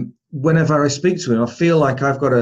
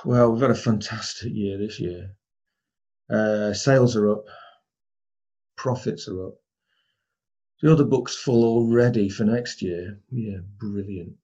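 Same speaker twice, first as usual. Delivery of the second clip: lower, much softer, slowing to a dull brilliant; flat and deflated; 4 semitones lower, 4 dB quieter than usual. Shown as a demonstration. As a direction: deliver good news as if it were bad. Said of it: "same words, but do you get a different message".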